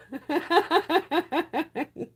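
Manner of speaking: high, pulsing belly laugh; long cackling laugh. Real, not acted. Streamer amused by her positive-thinking remark, high cackling laugh. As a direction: let a long string of ha-has roll out.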